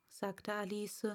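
plain reading voice

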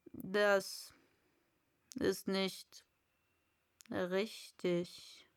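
low, hushed caterpillar, half whispered; a languid old caterpillar, lightly voiced; low murmured caterpillar. A narrator's reading. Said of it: "sagte die Raupe".

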